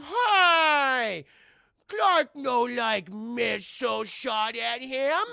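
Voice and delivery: slow, high, shouted brute roar; angry caveman Superman. Acted. To the League, dim Superman roars a furious threat. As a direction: bellow it like a wounded beast. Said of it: "And he's like".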